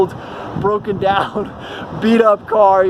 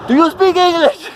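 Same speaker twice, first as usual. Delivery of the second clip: higher-pitched, halting foreigner's English; put-on foreign-accented English, pitched up; imitated broken English; 8 semitones higher than usual. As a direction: imitate a non-English speaker's memorized English phrase. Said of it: "being like".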